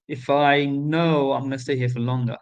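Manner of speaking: usual speaking voice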